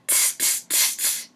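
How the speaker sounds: whispered tss tss; soft cheeky monkey tsk. Acted. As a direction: tsk back at him cheekily. Monkeys mock the peddler.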